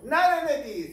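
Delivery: high, loud burst of no's; mock alarmed cry. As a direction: cry out in comic dismay. Acts out dreaded meeting.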